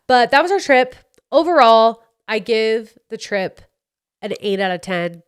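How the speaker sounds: normal talking voice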